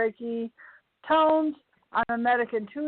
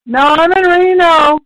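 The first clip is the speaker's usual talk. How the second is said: much louder, higher interjection; loud correction; 5 semitones higher, 16 dB louder than usual. Real, not acted. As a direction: call it out emphatically. Calls out a correction.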